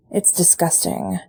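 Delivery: ordinary delivery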